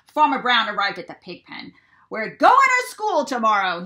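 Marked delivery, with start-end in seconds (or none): 2.1-3.8 loud proud announcement; announcing. A narrator's reading. Farmer Brown announcing the school trip to the pigs, loud. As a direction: proclaim it to the pigs. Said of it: "he announced".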